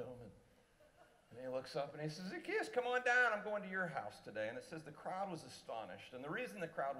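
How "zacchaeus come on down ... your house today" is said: much higher, louder friendly call. Retold or imitated. Jesus calling Zacchaeus down from the tree, loud.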